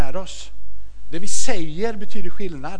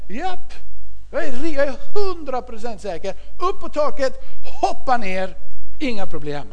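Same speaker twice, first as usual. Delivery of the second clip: confident cheer; 6 semitones higher, 4 dB louder than usual. Retold or imitated. Pappa försäkrar barnen.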